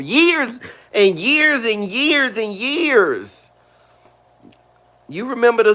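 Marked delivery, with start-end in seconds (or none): 0.0-3.4 high, slow, stretching every years; drawn-out emphasis. Real, not acted. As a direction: drag it out to show how long. Emphasizing a long wait.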